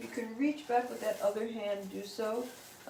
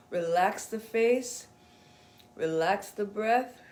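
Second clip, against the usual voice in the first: calm instructor pacing each breath; guided breathing cue; 3 semitones higher than usual. Acted. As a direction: ease the class into the breath.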